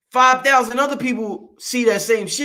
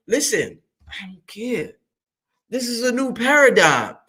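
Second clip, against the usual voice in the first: ordinary talk, nothing marked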